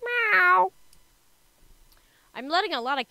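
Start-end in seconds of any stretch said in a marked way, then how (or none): 0.0-0.7 loud, high drawn-out meow; cat meow. Pretend. Player voicing a cat visitor, loud high meow.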